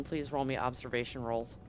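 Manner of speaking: ordinary delivery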